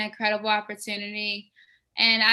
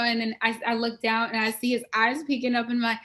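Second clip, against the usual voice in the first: usual speaking voice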